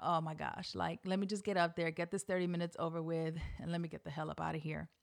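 low, soft nervous aside; retold private grumble, hurried at the end; inner thought. Nervous speaker wanting it over with, subdued. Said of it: "I thought".

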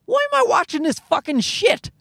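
high, fed-up cry of disbelief; exasperated outburst. Retold or imitated. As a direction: throw up your hands at the screen and shout it. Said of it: "And I look at it and I go".

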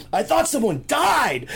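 high, loud, drawn-out mock outrage; pissed-off roommate. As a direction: wail it in imitated exasperation. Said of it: "he's pissed".

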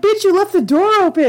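high exasperated scolding; mock scolding a horror heroine. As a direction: snap at the dumb character. Annoyed at the character.

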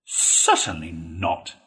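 "Certainly not" slow and drawn out; deliberate, weighty "Certainly not"; emphatic. A narrator's reading. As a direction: stretch "Certainly" into a firm refusal. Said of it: "replied Toad emphatically".

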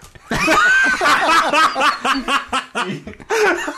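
huge loud guffaw right into the mic; real explosive belly laugh, high and loud; booming guffaw. Real, not acted. Laughing at the fire debate, a loud high guffaw. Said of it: "Such boisterous guffaws right into the mic".

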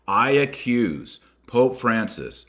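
grave, emphatic charge; solemn accusation. Acted. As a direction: pronounce it like a verdict, slowly. Reading the formal accusation.

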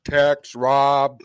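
ordinary talk

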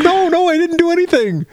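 high, whimpering put-on protest; mock whiny denial. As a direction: plead innocence in a squeaky fake whine. Ralph protesting innocence, mocked.

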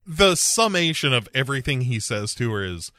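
normal talking voice

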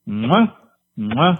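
loud smacking air kisses, rising; between kisses. Real, not acted. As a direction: blow big loud kisses down the phone. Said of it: "get ready, 'cause here it comes".

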